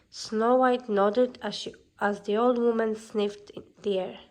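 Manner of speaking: ordinary delivery, nothing marked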